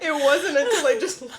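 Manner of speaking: high, breaking into laughter; giggly, tearful defence; laughing through tears. Real, not acted. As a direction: protest while cracking up.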